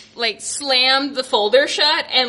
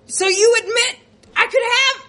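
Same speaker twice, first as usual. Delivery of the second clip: high indignant self; 9 semitones higher, faster than usual. Retold or imitated. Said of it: "And I was like".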